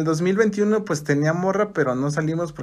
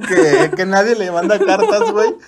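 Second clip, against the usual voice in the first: laughing; 3 semitones higher, 6 dB louder than usual. Real, not acted. Laughing at a friend's story.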